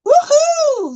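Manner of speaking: high, loud whoop; cheer. Real, not acted. Streamer cheering for the bubbles, high whoop. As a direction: let out a long high woohoo.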